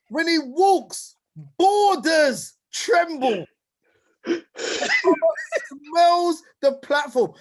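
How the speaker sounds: slow, rising preacher's build; preaching hype. Acted. Hype intro, preaching the guest.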